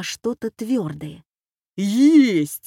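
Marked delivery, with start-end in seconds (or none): 1.8-2.7 loud, higher triumphant shout; excited cry. A narrator's reading. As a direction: cry out on finding it. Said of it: "закричал Боря". (радостно закричать)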